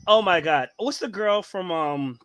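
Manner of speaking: usual speaking voice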